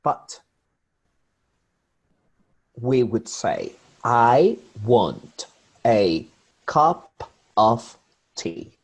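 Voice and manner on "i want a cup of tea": "I want a cup of tea" very slow, every word full and separate; word-by-word "I want a cup of tea", each fully stressed; over-careful pronunciation. Shown as a demonstration. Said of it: "pronounce this phrase perfectly".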